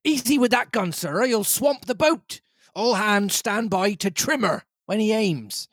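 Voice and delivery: high, urgent captain voice; captain warning not to swamp the boat; captain's voice. Acted. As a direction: warn the squire like a tense sea captain.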